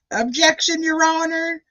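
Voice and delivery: high-pitched mock Southern objection; Southern accent Diener impression. Imitating the lawyer objecting to the judge, mock Southern accent. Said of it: "I was laughing so hard at your Southern".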